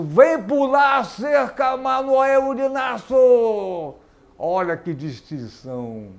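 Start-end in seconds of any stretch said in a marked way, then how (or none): 0.0-3.9 loud high announcer call. Acted. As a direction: shout the guest's name onto stage. Host calls guest onto show.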